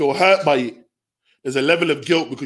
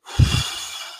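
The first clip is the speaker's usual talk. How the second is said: weary sigh. Retold or imitated. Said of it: "and I see him go".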